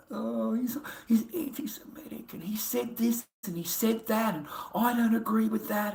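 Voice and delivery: high, soft, breathy mock complaint; mock whinger. Streamer imitating a complaining viewer, soft high mock whine. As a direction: whine it like a tattling child. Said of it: "have a fucking whinge".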